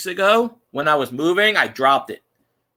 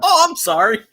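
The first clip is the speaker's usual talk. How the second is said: high, louder-voiced apology; put-on apology in a raised pitch; imitated apologetic caller; 8 semitones higher, 3 dB louder than usual. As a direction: imitate a flustered customer saying sorry. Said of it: "And they go".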